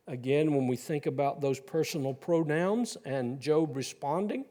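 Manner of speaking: ordinary talk, nothing marked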